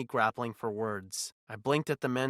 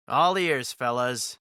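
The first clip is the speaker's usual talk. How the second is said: sardonic intrigue; 6 dB louder, slower than usual. A narrator's reading. Alex playing along with the visitors, sardonically intrigued. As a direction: play along with a sardonic edge. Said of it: "wrapping my intrigue in a layer of sardonic humor".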